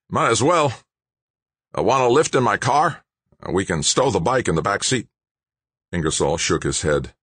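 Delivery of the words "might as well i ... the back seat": lightly raised, eager younger man; a hint of a keen, lively protester; Don's voice. Don voiced by narrator.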